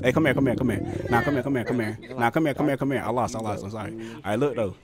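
ordinary delivery

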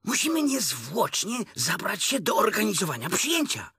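high, officious storybook rabbit; lightly voiced rabbit taking charge; giving orders. A narrator's reading. Rabbit organising the party, high-pitched ordering. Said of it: "zarządził Królik".

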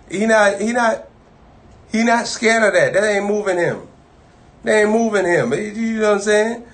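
rising sermon fervor; preaching build. Acted. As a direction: drive the point home from the pulpit. Preacher rises in pitch.

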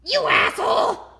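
loud high angry curse; furious shout. Acted. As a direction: yell it in pain and rage. Kicked again, furious.

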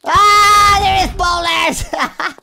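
loud, high, drawn-out yell; high shriek of alarm; startled scream. Real, not acted. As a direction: scream a warning, stretching the words. Screams as boulders appear.

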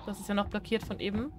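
usual speaking voice

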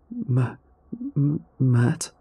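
frightened, quiet stammer; scared stutter. A narrator's reading. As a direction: stumble over the name in fear. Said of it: "Dennis stuttered".

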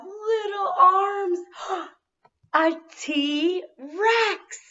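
high and loud with a gasp; dramatic excitement. Acted. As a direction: build suspense, then reveal. Dramatic hint and reveal.

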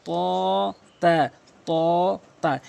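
"ত" heavy and rounded, "তা" light and spread; round versus spread. Shown as a demonstration. Teacher contrasting two letters, round "ত", spread "তা". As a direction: alternate long round "ত" with short thin "তা". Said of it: "তটা মুখ গোল হবে আর তাটা".

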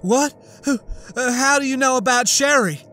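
jolted awake by her daughter's name; shocked cry. A narrator's reading. Annette shocked at Sherry's name.

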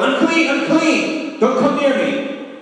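leper's yell. Retold or imitated. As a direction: cry out to keep people away, pitched high. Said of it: "you have to yell".

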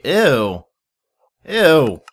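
loud disgusted ew; sharp, loud disgust; grossed out. Real, not acted. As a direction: recoil from it. Disgust at pee bucket item.

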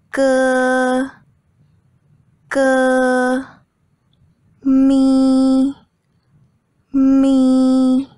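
Ma, Ge, Mi, Wu on one high, steady pitch to the end; high level first tone. Shown as a demonstration. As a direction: hold Ma, Ge, Mi, Wu high and even. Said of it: "high pitch and even for the entire syllable".

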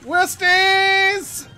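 shrill frantic scream, high and loud; panicked scream. Real, not acted. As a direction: scream as they swarm you. Attacked by Ewoks in game.

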